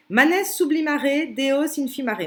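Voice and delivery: reciting Latin. Shown as a demonstration. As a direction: declaim the Latin line with weight.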